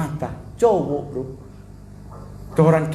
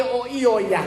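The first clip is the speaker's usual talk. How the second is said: put-on Manado accent; 6 semitones higher, slower than usual. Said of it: "dia bagi".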